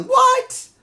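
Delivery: very high-pitched disbelieving cry; incredulous. Acted. Reviewer reacting to a wrestler's ridiculous shirts, high-pitched and incredulous. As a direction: let the voice leap way up in disbelief.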